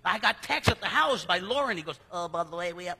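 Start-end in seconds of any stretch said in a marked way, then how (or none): 2.1-3.0 lightly put-on, lower imitation of a colleague; mock texter's voice. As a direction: quote him in a slightly silly voice. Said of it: "he doesn't talk like that".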